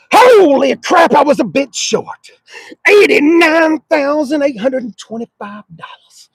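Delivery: loud, high shocked price reading; sticker shock. Real, not acted. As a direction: gasp out the price in disbelief.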